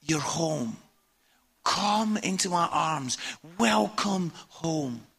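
soft, low and slow, a gentle invitation; tender welcome home; God's welcoming voice. Acted. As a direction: speak as God calling a child home, hushed. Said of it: "God calls you and says".

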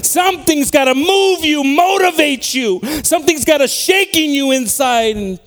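high, loud, driving sermon voice; impassioned preaching. Real, not acted. Preacher urging church to be moved and awakened, impassioned preaching. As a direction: preach it at full force, punching the key verbs.

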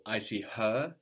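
"her" drawn out at the end; slow line landing on "her"; stress on HER. Shown as a demonstration. Teacher prompting student for emphatic her, stress on "her". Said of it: "emphasizing her".

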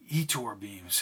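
hushed, low whisper. Acted. Whispering secret words to Charlie, hushed and low. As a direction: whisper it close to her. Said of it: "he whispered".

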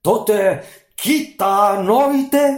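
furious genie bellowing; genie shouting. Acted. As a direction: shout at the fool. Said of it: "φώναξε".

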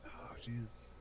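whispering a suspicion about the stewardess. Retold or imitated. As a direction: breathe it like a secret realization. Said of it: "I was like".

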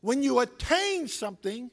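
slow, weighty sermon delivery; deliberate pulpit cadence; slow preaching. Acted. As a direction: lean on every word.

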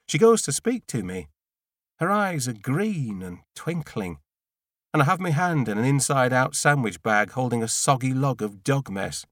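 plain reading voice

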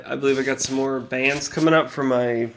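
ordinary talk, nothing marked

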